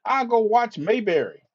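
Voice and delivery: usual speaking voice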